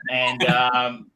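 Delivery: ordinary delivery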